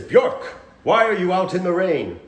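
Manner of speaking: loud, high-pitched English stage voice; English accent. Acted. Scene partner calling his Scandinavian brother, hammed-up English accent. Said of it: "he's using an English accent".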